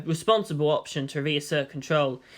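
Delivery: ordinary delivery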